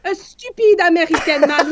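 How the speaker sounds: a hint of a French accent, laughing; half-hearted French accent, said on request; light French accent. Shown as a demonstration. Guest imitating the host's line in a light French accent. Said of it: "say it with your French accent".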